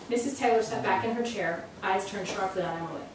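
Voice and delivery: plain reading voice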